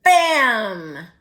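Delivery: a sudden loud shouted bam; loud bang. A narrator's reading. Narrator startles the listener as the cookie leaps out, loud. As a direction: burst out like the oven door flying open.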